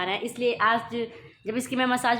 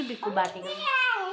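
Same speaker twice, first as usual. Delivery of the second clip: put-on much higher, squeaky baby-talk sulk; mock sulk; 11 semitones higher than usual. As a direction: pout it out in a tiny high voice. Mother mock-sulks at baby. (बहुत ऊँची, रूठी हुई तोतली आवाज़ में)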